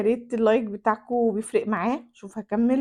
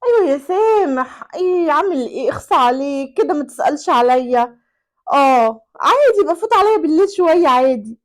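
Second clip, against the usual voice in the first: higher, flirty sing-song phone voice; flirty mistress; 3 semitones higher, 8 dB louder than usual. Acted. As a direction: coo down the phone to another man. العشيقة تكلم رجلاً آخر بدلال. (العشيقة تغازل سامح على الهاتف بدلع)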